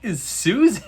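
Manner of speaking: high, baffled "who is that"; baffled outburst. Retold or imitated. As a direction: squeak out the confused question. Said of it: "I was like".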